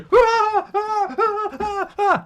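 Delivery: high squealing laugh mimicking her panic; shrieking laugh. Retelling the snowman scare, a shrieking laugh.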